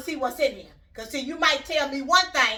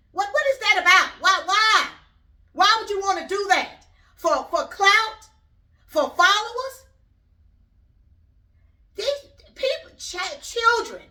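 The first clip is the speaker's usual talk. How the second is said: higher and louder, fired-up disbelief; exasperated outrage; 6 semitones higher, 4 dB louder than usual. Real, not acted. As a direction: demand why, voice up and loud. Indignant rant about challenge.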